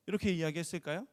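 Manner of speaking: ordinary talk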